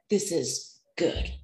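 a hint of a calm, approving God; voicing God. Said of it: "He said".

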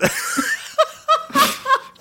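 very high laugh; squealing laugh. Real, not acted. Player cracking up at falling in love, a high squealing laugh. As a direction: shriek with laughter.

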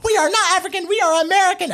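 very high, hammed-up squawk of protest; mock screaming. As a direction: imitate indignant girls shrieking back. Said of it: "And there's a whole screaming".

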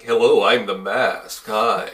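slow, smooth game show host greeting; lightly voiced smarmy TV host. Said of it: "he sounds like a game show host".

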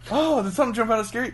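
high, quick mock question; mock visitor question. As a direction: ask it like a naive visitor. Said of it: "imitating a visitor".

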